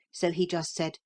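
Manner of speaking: ordinary delivery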